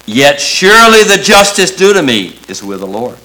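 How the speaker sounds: forceful, high preached quote; emphatic declaration of trust. Acted. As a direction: ring it out as the confident answer. Preacher voices Messiah's answer of trust.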